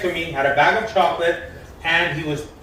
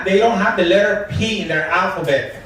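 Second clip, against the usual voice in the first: ordinary talk, nothing marked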